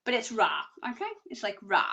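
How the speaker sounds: "Ra" with a long open ah; low-pitched, teach "Ra" as rah; pronunciation of Ra. Shown as a demonstration. Psychic teaching how to remember the rune, saying "Ra" as rah. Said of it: "That's how you gotta remember it".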